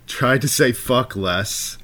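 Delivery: slow, deliberate reading of the note; reading a note to himself. Retold or imitated. Reading his own screen note aloud, slow and deliberate. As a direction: read the reminder out slowly. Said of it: "I've left notes for myself".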